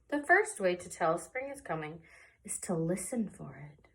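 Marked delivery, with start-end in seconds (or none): 2.4-3.9 hushed whisper. A narrator's reading. Reader hushes the children to listen, whispered. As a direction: breathe it out as a secret.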